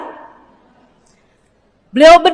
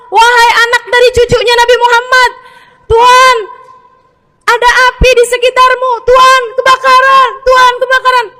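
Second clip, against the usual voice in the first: high, loud alarmed shouts; people shouting; 10 semitones higher, 4 dB louder than usual. Retold or imitated. People shout to the praying master that the house burns. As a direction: yell a fire warning. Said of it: "Orang-orang pada teriak-teriak".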